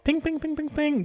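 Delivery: high, soft, rapid pings; ping like a little alert going off; imitated alarm pinging. Self-defense brain starts going off, high alarm pings. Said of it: "brain starts going off".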